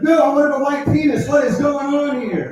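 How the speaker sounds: high, loud panicked squeal; panicked outburst. Retold or imitated. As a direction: shriek it in mock horror.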